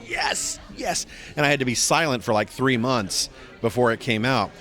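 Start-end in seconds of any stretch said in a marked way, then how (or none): 0.1-1.1 inner cheer. Retold or imitated. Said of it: "inside I'm going".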